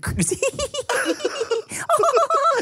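high giggle imitating the wife; imitated sleepy laugh. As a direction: mimic her sleepy giggle. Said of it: "that's how she laughs, right?".

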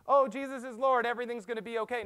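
high, loud, breezy mock optimism; mock pie-in-the-sky cheer. Preacher mocking shallow faith with breezy cheer. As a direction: toss it off like empty happy talk. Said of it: "pie in the sky thinking that goes".

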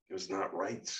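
ordinary delivery, nothing marked

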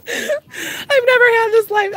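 giddy, can't believe it; real laugh-cry of joy; laughing disbelief. Real, not acted. Stunned by viewer donations.

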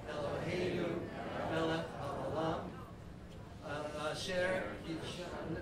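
slow, measured blessing over the shofar; prayer recitation. Acted. Rabbi leading the congregation in the shofar blessing, slow and measured. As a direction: intone each Hebrew word slowly like a prayer.

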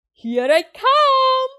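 high, loud, drawn-out shout; Tigger shouting back. A narrator's reading. Tigger chases Roo, shouting back. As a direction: holler it long on the last word. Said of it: "Tigger shouted back".

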